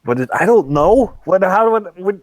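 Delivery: high, loud flustered protest; flustered hostage. Acted. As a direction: panicky fumbling for an answer. Hostage flustered by questions on phone.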